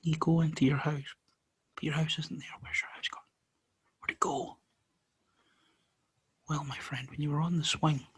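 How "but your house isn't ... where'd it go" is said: hushed spooky whisper. A narrator's reading. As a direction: whisper it like a mystery. Spooky story, house gone.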